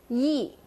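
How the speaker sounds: "yi" short and sharply falling; sharp fourth tone. Shown as a demonstration. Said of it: "You kinda say it sharply".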